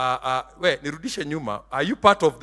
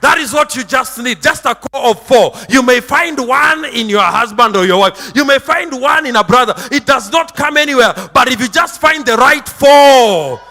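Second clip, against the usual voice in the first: high, loud, sing-song preaching; fiery preaching; 5 semitones higher, 8 dB louder than usual. Acted. Pastor preaching to his flock, loud and chanting. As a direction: preach it out to the congregation.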